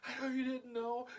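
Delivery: high mock-tearful voice; retold crying. Hugging the sorry sister, high imitated crying. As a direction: comfort her through pretend tears. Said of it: "and I was crying".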